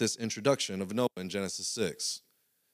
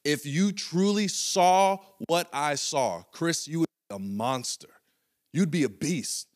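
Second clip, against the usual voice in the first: higher, slow plea; coach pleading; 5 semitones higher than usual. Retold or imitated. Coach pleading with Chris, higher and slow. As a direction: appeal to his potential.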